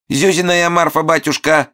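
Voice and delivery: sugary high falsetto, sing-song; sweet sing-song. A narrator's reading. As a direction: sing it sweetly like a pitiful widow. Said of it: "сладким голосом пропел Момус".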